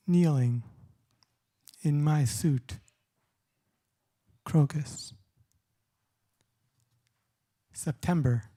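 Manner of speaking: measured spoken-word poem delivery with long pauses; unhurried poet at a reading, every line weighed; poetry reading. Acted. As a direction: read each line like a poem, letting the pauses breathe. Reads poems aloud.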